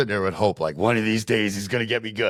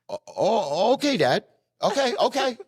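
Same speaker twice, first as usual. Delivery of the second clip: put-on high sheepish kid voice. Child answering father.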